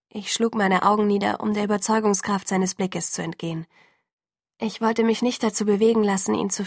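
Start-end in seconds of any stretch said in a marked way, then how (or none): none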